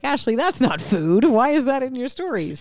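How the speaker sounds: high, loud mock complaint; imitated objection. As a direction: object like an indignant listener. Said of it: "and you're like".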